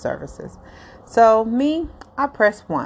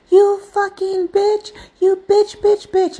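high, loud, slow cursing yell at the caller; furious shout; 12 semitones higher, 4 dB louder, slower than usual. Retold or imitated. Scammer losing it at a woman who wouldn't cooperate, yelling. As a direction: spit the curses out like a fed-up scammer. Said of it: "he finally got so frustrated with my questions".

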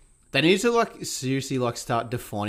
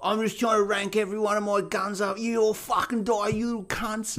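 much higher, mock whiny abusive gamer; angry gamer; 10 semitones higher than usual. Imitating the abusive gamer ranking up his guns, high and whiny. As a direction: whine it like a sore troll. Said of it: "He's like".

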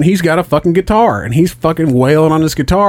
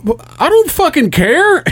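high-pitched, mock exasperation; exasperated retort. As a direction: throw it back with the voice way up. Retold exasperated answer to wife.

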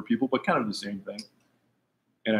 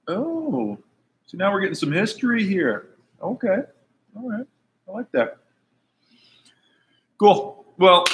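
excited; 11 semitones higher, 4 dB louder, slower than usual. Real, not acted. Excited reaction to news.

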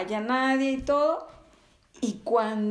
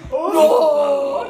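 loud, higher laughing possessed "No"; a mock demonic cry of "No"; 5 semitones higher, 10 dB louder than usual. Redoing the brother-in-law's cry as a possessed mock wail. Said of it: "es con esa voz de posesión".

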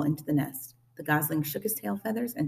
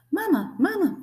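put-on high, tiny baby-bird voice; little high-pitched chick calling out; peeping gosling; 4 dB louder than usual. A narrator's reading. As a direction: peep it like a newly hatched gosling. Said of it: "peeped the gosling".

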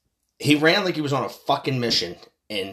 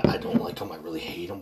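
hushed; 5 semitones lower, 6 dB quieter, faster than usual. Real, not acted. Quiet aside.

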